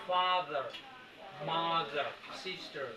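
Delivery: American accent, every final r sounded. Shown as a demonstration. Teacher contrasts accents for students, American r-ful pronunciation. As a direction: lean on the American r ending each word. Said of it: "American, এটাকে বলে তারা".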